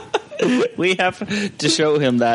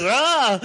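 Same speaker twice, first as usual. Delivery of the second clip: imitated yelp; 14 semitones higher than usual. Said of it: "he goes".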